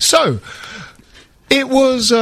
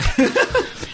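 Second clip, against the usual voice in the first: laugh; 5 dB quieter than usual. Real, not acted. Laughs again.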